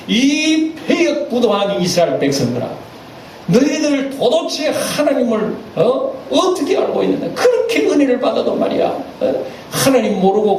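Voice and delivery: high, strained mock fury at the people; hammed-up rage, pitch climbing high; Moses' angry outburst. Moses rebukes the complaining Israelites, mock furious. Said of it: "화를 벌컥 내버리는 거예요".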